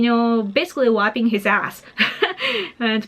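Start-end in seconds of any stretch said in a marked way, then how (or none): 2.0-2.7 high burst of laughter. Real, not acted. Laughs at own crude joke.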